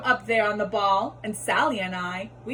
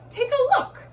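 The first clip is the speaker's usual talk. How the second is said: showy cat reveal; 4 dB louder than usual. Acted. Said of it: "said the cat".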